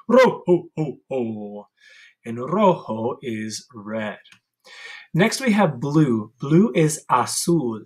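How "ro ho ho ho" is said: Santa ro ho ho. Shown as a demonstration. Teacher leading the class in the Santa chant. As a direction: call it out like a jolly Santa. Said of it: "go ro, ho, ho".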